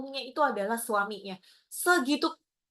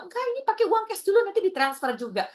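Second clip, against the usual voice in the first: insistent husband; 8 semitones higher, faster than usual. Retold or imitated.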